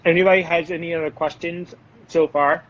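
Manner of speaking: talking while chewing chocolate; mouth full. Real, not acted. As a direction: speak through a mouthful. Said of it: "talking with my mouth full".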